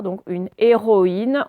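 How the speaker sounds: ordinary delivery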